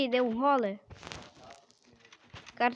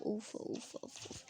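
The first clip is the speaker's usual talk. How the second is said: breathless relief; 2 semitones lower, 16 dB quieter than usual. Real, not acted. Relief after escaping a fall.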